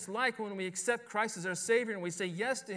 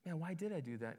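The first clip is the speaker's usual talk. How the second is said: softer, musing to oneself; quiet, pensive self-questioning; thinking aloud; 3 semitones lower, 9 dB quieter, faster than usual. Acted. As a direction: act out pausing to wonder at yourself. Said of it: "stop and think and go".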